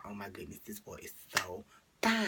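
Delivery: swooning over a crush across the room; awed whisper. Retold or imitated. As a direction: whisper it like he might hear you. Seeing crush first day.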